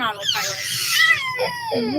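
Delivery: very high, slow drawn-out cry; mock wail. Exhausted mom hamming up her own tiredness, very high slow wail. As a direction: wail it out like a worn-out cartoon.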